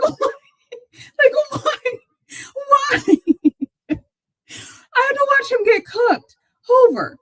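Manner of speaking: high, teary laugh-crying through the words; laughing so hard it sounds like sobbing; laughing till crying. Real, not acted. Laugh-crying over painful game memory.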